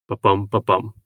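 Ba-bum, ba-bum with the second syllable bum hit hard each time; iambic beat. Shown as a demonstration. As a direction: tap out the iamb da-DUM, da-DUM. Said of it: "sounds like one foot following another".

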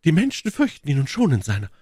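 high, fast woman's voice; goading Milady. A narrator's reading. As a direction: goad him, high and quick. Milady goads Felton.

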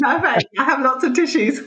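laughing as she offers the tissues. Real, not acted. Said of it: "like I just said to you".

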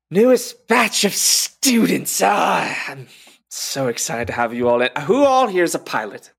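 big, high, booming welcome to new students; excited greeting. Acted. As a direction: enthusiastic flight teacher addressing the class. GM voices teacher greeting new students.

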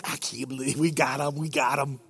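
low, hushed gleeful aside; imitated snickering. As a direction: snicker it quietly to your buddies. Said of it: "they're, like, snickering".

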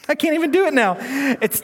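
embarrassed laugh, high and bright; laughing at himself. Real, not acted. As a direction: chuckle at fumbling the name. Laughing at own stumble.

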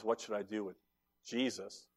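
low, very soft, halting question; hushed question. Retold or imitated. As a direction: ask it quietly, pausing before the name. Pilate's hushed question after crowd's cry.

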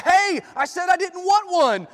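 high, loud indignant yell; yelled protest. Acted. Scott yelling at the professor over the unwanted donut, indignant shout. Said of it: "And Scott yelled out".

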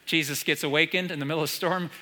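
ordinary talk, nothing marked

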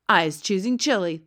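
loud giant announcing his plans; blood bottler, boastful giant. A narrator's reading. Blood bottler tells the B F G his plans, boasting loudly.

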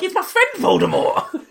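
high mock pride; proud introduction. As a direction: show off your friend. Bragging about knowing Voldemort.

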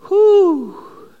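loud, high whew after the outburst; whistling exhale. Acted. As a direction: blow out a long stunned whew. After the acted tirade.

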